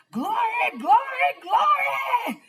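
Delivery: very high, loud shouted glory; jubilant cry. Acted. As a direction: shout it out to heaven in joy.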